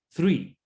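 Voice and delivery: three with tongue between the teeth; three with a th sound; English th. Shown as a demonstration. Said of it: "lengua entre medio de los dientes". (three con la lengua entre los dientes)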